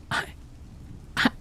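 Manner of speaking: high, broken, stammering apology; weeping. A narrator's reading. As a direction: stammer through tears. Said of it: "she wept".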